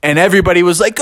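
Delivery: usual speaking voice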